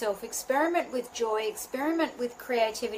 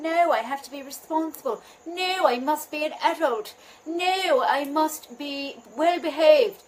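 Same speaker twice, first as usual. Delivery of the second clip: put-on higher-pitched, prim English-accented inner voice; 5 semitones higher, 5 dB louder than usual. Voice inside your head telling you to be responsible, English accent. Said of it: "suddenly I'm in an English accent".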